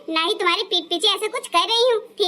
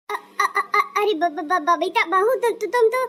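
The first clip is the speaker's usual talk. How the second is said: stammering in panic. Acted. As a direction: caught off guard, stuttering on every first syllable. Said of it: "आपकी जबान तो लड़खड़ा रही है". (घबराकर हकलाती हुई सास)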